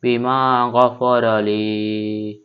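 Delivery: বিমা গফর আলী with রা then লী each held one beat; one-alif stretch. Shown as a demonstration. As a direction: stretch the vowel one count. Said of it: "এক আলিফ টেনে পড়তে হয়". (এক আলিফ পরিমাণ টেনে পড়া)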